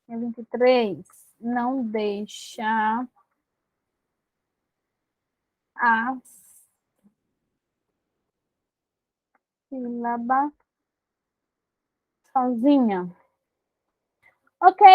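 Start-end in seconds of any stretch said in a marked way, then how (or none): 1.4-13.1 slow, paused-out dictation of the rule. Shown as a demonstration. Teacher dictating the rule to the class, slowly. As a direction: let each word land for the students.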